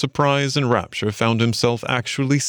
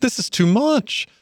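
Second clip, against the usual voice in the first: high-pitched, rapturous exclamation; ecstatic. A narrator's reading. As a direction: overcome with joy, voice soaring. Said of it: "cried the Mole in ecstasies".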